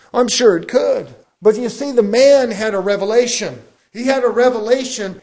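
preaching at full force. Real, not acted. Preacher to his church, building into loud preaching. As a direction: drive the point home to the congregation.

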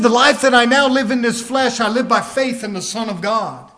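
loud proclamation easing at the end; preacher declaring scripture; full-force preaching. Real, not acted. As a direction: burst out with the verse.

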